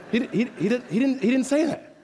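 worked-up preacher stammering his correction; excited stammer. Real, not acted. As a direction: blurt it out, fired up. Preacher stumbling, emphatic correction.

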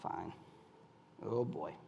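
very low, barely voiced reply; acted clammed-up husband, hushed and low; quietly upset. Upset husband answering his wife about the meeting, very quiet. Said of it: "now I get quiet".

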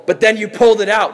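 hammed-up self-righteous objection; accusing Pharisee. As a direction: pounce on the offender, high and loud. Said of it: "That's what the Pharisees would do".

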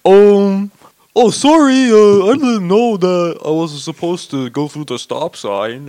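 high, loud, slow dopey-guy voice; dumb straight man. Retold or imitated. Man imitating someone mimicking him, mock dumb straight-guy voice. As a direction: drag it out like a clueless dope. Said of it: "that's a straight man's voice they're doing".

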